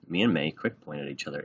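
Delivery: usual speaking voice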